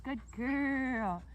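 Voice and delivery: loud, drawn-out praise to a dog; lavish praise. Real, not acted. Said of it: "lots and lots of praise".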